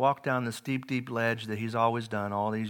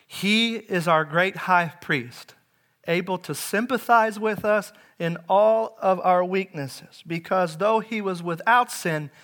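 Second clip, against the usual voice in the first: loud, high, measured proclamation; ringing, emphatic sermon voice; impassioned preaching; 7 semitones higher, 7 dB louder than usual. Real, not acted. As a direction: proclaim it from the pulpit with mounting conviction. Preaches at full force.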